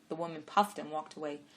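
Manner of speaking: usual speaking voice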